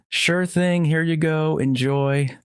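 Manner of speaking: friendly barista. A narrator's reading. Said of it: "The barista smiled warmly".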